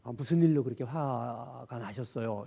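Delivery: a calm, caring question; calm gentle question. Shown as a demonstration. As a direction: ask it calmly to settle them. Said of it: "이게 차분하게".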